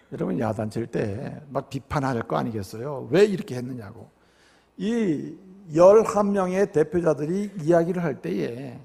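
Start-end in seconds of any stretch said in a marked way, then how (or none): none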